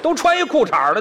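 ordinary delivery

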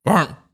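sudden high-pitched imitated bark; one short, fierce woof; fierce dog bark. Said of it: "然后狠狠地".

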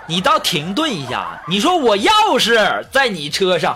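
usual speaking voice

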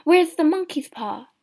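high, loud urgent question; frantic searching question; panicked thought. A narrator's reading. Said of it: "he thought".

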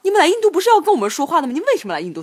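学小贩连珠炮似地质问; 忽高忽低、急促地假装质问; 模仿怒声质问. Retold or imitated. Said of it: "翻译成中文就是这样子的". (急冲冲地模仿质问)

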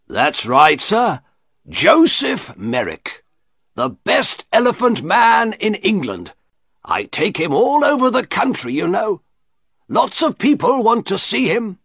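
high-pitched, eager showman's patter; boastful shopkeeper. A narrator's reading. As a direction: boast proudly of your star exhibit.

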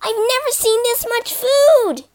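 very high mouse squeak; high-pitched, wonderstruck little mouse voice; excited squeak. A narrator's reading. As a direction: squeak it out in amazement. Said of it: "he squeaked".

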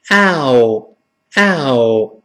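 ow as ah gliding slowly into oo; linked diphthong. Shown as a demonstration. Teacher joins two vowels for learners, drawn-out ow. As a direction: stretch ow, two short vowels joined together. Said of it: "连到一块儿".

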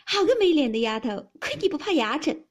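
playful loud scolding; teasing jeer. A narrator's reading. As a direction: burst in teasing from hiding. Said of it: "山石背后哈哈的笑道".

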